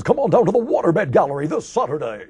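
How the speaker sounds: loud, high-pitched hard-sell radio pitch; mock a small-market disc jockey belting out a commercial; local DJ ad read. Voiceover artist imitating cookie-cutter local commercials, mock DJ hard sell. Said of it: "done by F M disc jockeys".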